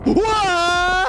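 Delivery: sudden high yelp of surprise; startled shout. Real, not acted. Gamer startled by a monster, high yelp. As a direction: jump at the scare.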